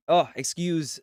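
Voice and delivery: ordinary talk, nothing marked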